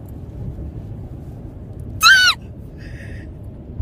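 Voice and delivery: high, breathy shriek of laughter. Real, not acted. In the car with her new book, a shrieking laugh. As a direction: let out a giddy squealing laugh.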